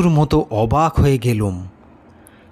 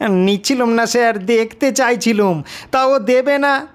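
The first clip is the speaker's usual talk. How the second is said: put-on high, pleading boy's voice; defensive schoolboy; 7 semitones higher than usual. A narrator's reading. Accused schoolboy protesting to the teacher, high and defensive. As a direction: protest your innocence like a caught kid.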